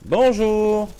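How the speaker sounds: high, loud, drawn-out hello; mock doorstep preacher. Streamer playing a doorstep evangelist to viewers, mock-cheery greeting. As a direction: burst in like a pushy evangelist.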